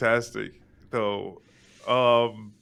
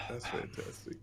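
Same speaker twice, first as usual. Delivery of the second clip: whispered; 18 dB quieter than usual. Real, not acted. Whispered aside.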